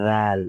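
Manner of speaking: লাল said light and thin, teaching the Arabic sound; light Arabic letter. Shown as a demonstration. Teacher showing students a light লাল. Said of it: "উচ্চারণ হবে একদম পাতলা".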